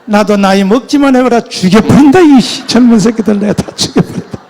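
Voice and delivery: loud ranting vow of revenge; acted outburst of rage; furious threatening. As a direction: explode in resentment, shouting. Retold vow of revenge at elders.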